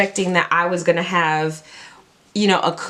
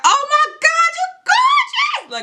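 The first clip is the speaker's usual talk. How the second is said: fangirl gush. Retold or imitated. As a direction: blurt it out unable to hold back. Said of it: "I was like".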